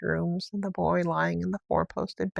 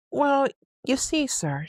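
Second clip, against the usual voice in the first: a lightly voiced flustered housekeeper, higher and slow; 7 semitones higher, slower than usual. A narrator's reading. Housekeeper flustered before her master, lightly voiced. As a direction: stammer it out, a touch flushed. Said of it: "Missus Medlock really was flushed".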